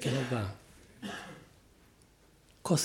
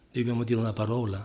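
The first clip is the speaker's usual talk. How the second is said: ordinary delivery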